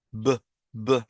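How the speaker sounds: "b, b" as short voiced "buh" pops; two separate bursts of "buh" for "b, b"; letter sound of B. Shown as a demonstration. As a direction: say "b, b" as sounds, not letter names. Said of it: "sino el sonido".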